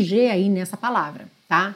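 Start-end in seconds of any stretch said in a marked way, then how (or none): none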